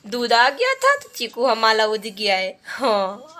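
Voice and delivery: high, loud, sing-song cooing. Real, not acted. Mother cooing to her baby Chiku.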